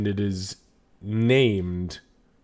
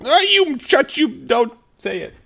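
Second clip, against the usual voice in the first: scolding; 6 dB louder than usual. Retold or imitated. Scolding kids mid-joke.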